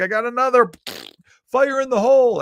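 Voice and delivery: very high, loud comic warning; gleeful farter. Acted. Farter warns crewmates of another one, mock shouted alert. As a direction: call it out high and drawn, announcing the blast. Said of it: "And he's like".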